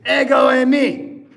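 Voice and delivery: loud ringing call of ego eimi; shout. Shown as a demonstration. Jesus shouting over the waves to the disciples, proclaimed shout. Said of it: "He shouts that one for sure".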